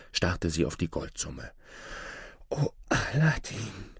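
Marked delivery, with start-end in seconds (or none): 2.5-4.0 high, slow, drawn-out exclamation; astonished mother. A narrator's reading. Mother stunned by the gold, astonished. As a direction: exclaim slowly, overcome by astonishment. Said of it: "rief die Mutter nun".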